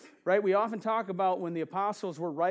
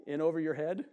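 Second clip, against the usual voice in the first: ordinary delivery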